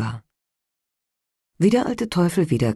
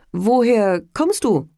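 startled innkeeper; 7 semitones higher than usual. Acted.

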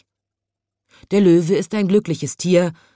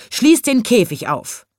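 raised, loud lion's command; grumbling lion; 7 semitones higher, 5 dB louder than usual. A narrator's reading. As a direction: demand it as the grumpy storybook lion. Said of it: "grollte der Löwe". (fordernd grollender Löwe)

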